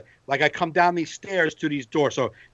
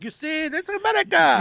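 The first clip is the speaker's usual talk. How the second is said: high, proud showing-off voice; mock patriot; 10 semitones higher than usual. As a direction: make sure the stranger is on board.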